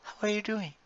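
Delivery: very high, soft, sugary hostess voice; fake-nice waitress. Waitress returning to the floor, very high sugary greeting. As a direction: switch back on the sweet service smile. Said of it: "she goes through the".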